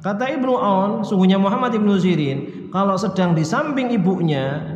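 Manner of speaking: ordinary talk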